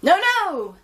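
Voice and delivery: high, loud refusal; piglets cry no. Acted. Piglets refusing Gran from inside, loud and high. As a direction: shout the no's back through the door. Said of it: "the piglets cried within".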